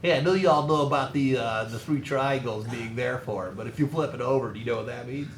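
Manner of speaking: teacher copying a nerdy voice; nerdy know-it-all impression; nerd voice. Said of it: "Is that your nerd voice?".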